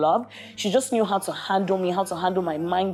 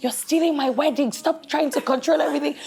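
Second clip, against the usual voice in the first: mock indignant complaint; 9 semitones higher than usual. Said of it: "initially I was like".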